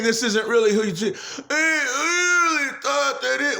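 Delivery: a half-laughing, half-crying distorted take on the voice; crying mock voice. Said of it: "and now they're saying".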